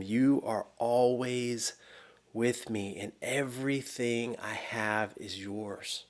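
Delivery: father soothing his angry son, soft and slow; tender father. Retold or imitated. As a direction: ease it out gently, like a loving dad. Father gently answers the older son.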